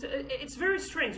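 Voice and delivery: ordinary delivery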